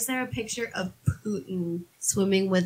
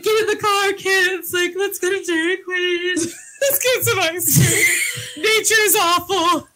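high, loud mock-upset shouting; distraught parent; 10 semitones higher, 5 dB louder than usual. Parent hurrying kids away from the ducks, mock distraught shout. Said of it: "And I was just like".

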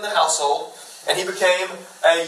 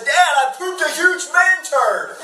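put-on high, drawn-out proud little-kid announcement; small son's voice; 8 semitones higher, slower than usual. Dad retelling his two-year-old's funny announcement, high mock kid voice. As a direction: imitate a small boy proudly reporting to Dad. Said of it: "Ransom came out last week".

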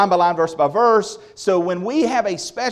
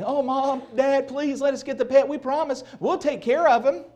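put-on higher pleading child voice; begging kids; 9 semitones higher than usual. Preacher imitating children begging parents for a pet, mock pleading.

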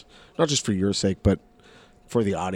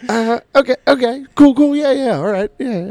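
high, loud flustered okays with a laugh; awkward fake enthusiasm; 8 dB louder than usual. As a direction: bluff through the panic with forced cheer. Awkward reply to a bad suggestion.

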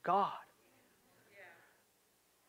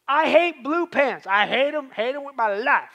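hammed-up loathing, voice raised; emphatic mock hatred; 4 semitones higher, 8 dB louder than usual. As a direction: play at hating it with full force. Voices pastor's emphatic hatred in example.

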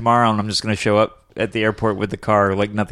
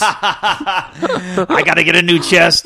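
greedy mechanic voice; 7 semitones higher, much slower than usual. Retold or imitated.

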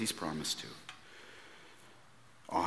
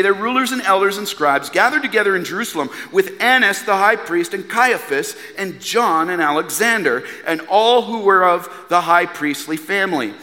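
ordinary talk, nothing marked

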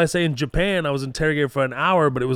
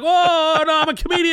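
panicked denial; 5 dB louder than usual. Retold or imitated.

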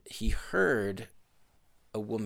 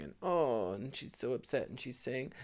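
usual speaking voice

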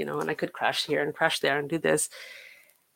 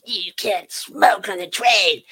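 put-on loud, slow, aggressive German drunk; drunk German guy; 6 dB louder, slower than usual. Drunk German man scolding her for smoking on the train, loud and aggressive. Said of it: "some weird German guy was, like, drunk".

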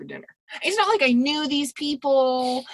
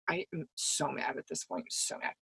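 very soft, low seething aside; retold quiet fury; 8 semitones lower, 17 dB quieter than usual. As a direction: recall the anger under your breath. Recalling silent fury at the table.

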